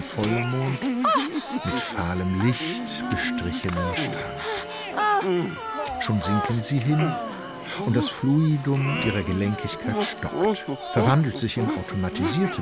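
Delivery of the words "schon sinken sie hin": ordinary talk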